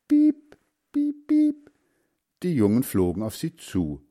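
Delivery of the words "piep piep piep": very high, tiny squeaks; duckling peeps. A narrator's reading. Ducklings answer their mother's offer of food, peeping. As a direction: peep eagerly like hungry ducklings.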